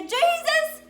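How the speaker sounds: loud, high pleading call to Jesus; crying out. Acted. Blind beggar shouting to Jesus for mercy. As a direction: call out his name across the street. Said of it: "Just like Bartimaeus, he said".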